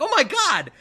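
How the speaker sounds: high retold cry of disbelief; shocked exclamation. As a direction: recall being shocked, pitch leaping up. Retells own shocked movie reaction.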